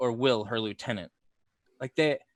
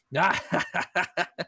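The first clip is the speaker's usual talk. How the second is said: mocking soldier laugh; 4 semitones higher than usual. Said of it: "the soldiers start laughing".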